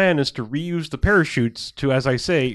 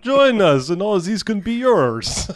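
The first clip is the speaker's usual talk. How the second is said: mock villain offer; 7 semitones higher, 3 dB louder, slower than usual. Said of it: "where it's just like".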